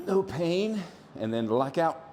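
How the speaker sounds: ordinary delivery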